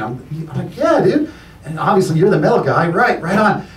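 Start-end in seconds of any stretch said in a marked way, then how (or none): 0.8-1.3 high-pitched, flattered fanboy reply; eager agreement. Retold or imitated. Comic answering an admired peer, eagerly agreeing. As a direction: pipe up eagerly, pitch jumping.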